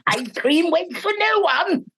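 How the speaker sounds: put-on high, lilting call to children; hammed-up singsong summons, drawn out at the end; playful character voice. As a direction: coax the little ones along in a put-on voice. Voices an imagined animated character.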